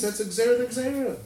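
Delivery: high retold outcry at moderate volume; mock scream. Teacher quoting the Rebbe's objection, mild mock scream. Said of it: "Rebbe screams".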